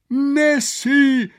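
high, loud cry of delight; enthusiastic cry. Acted. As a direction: call it out, thrilled. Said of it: "rief Professor Quakenbrück begeistert aus".

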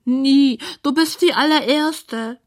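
high, loud opening wail; squeaky pig lamenting; lonely pig. A narrator's reading. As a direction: complain in a high little pig voice. Lonely pig answers Clara.

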